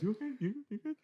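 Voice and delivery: high, upturned mock questioning; imitated repeated check-in. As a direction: mimic an insistent check-up, pitched right up. Mimicking the repeated check every time.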